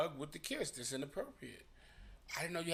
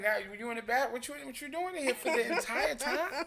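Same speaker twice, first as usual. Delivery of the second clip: high, louder nagging partner voice; nagging partner voice, pitched up; mocking partner; 10 semitones higher, 4 dB louder than usual. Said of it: "it's like".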